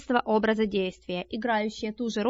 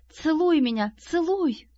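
higher, mildly breathless plea; gasping; 5 semitones higher, slower than usual. A narrator's reading. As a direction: urge it in a raised pitch, lightly gasping. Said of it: "задохнулась Шайна". (слегка задыхаясь, повыше)

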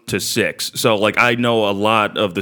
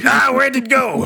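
Mickey voice; 13 semitones higher than usual. Retold or imitated. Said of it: "Mickey's heart ripped out".

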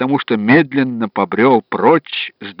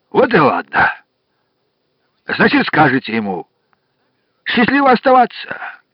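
jolly farmer voice; 2 semitones higher than usual. Acted. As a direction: play a chatty farmhand. Farmer caller's voice.

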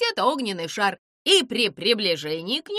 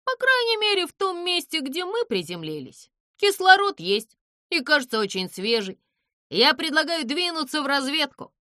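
higher, louder upbeat voice; cheerful; 7 semitones higher, 5 dB louder than usual. Acted. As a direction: cheerfully propose a scouting trip. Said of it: "весело сказал он".

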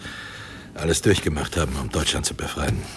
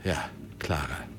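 much softer, slow and final; hushed, unbending confirmation; quiet insistence; 4 semitones lower, 10 dB quieter than usual. Acted. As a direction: lay it down gently but leave no room. Editor firmly insists on clarity.

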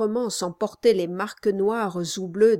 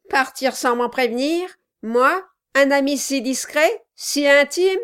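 sarcastic dwarf voice; 4 semitones higher, 6 dB louder than usual. Acted. Dwarf feigning hurt friendship.

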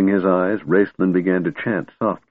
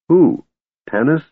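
eager, higher and louder question; an eager burst, pitched up; 8 semitones higher, 4 dB louder than usual. Acted. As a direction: jump in hopefully. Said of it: "Caramon said eagerly".

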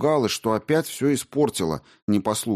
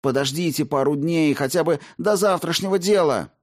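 justifying himself; 7 semitones higher than usual. Acted. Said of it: "оправдывался Коломна".

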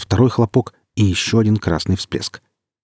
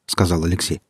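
plain reading voice

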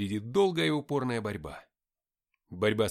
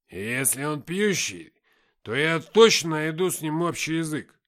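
put-on drunken Никита; 3 semitones higher, 9 dB louder than usual. A narrator's reading. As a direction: speak up like a cheerful drunk, stretching words. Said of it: "сильно поддатый Никита". (громкий пьяный говор)

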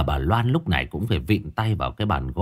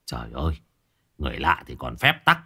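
lower, softer, calmed down; softened; 7 semitones lower, 4 dB quieter, faster than usual. Acted. Neighbor calming down toward his old friend, softer. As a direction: let the anger melt away. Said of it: "Ông Bảy bấy giờ mới dịu xuống".

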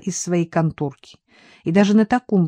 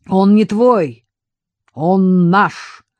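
louder, preachy and emphatic; lecturing; 3 semitones higher, 7 dB louder, much slower than usual. A narrator's reading. Vadim instructing Viktor, in a lecturing tone. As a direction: set him straight like a teacher. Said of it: "назидательно произнёс Вадим".

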